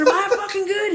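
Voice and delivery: frantic, half laughing; panicked laughing. Retold or imitated. Dazed victim asks the stranger, panicked and laughing. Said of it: "I said".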